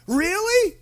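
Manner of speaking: high, rising incredulous question; shocked disbelief. Retold or imitated. Habakkuk questioning God using evil people, shocked disbelief. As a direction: question it as if you cannot believe it. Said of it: "sh-, shocked".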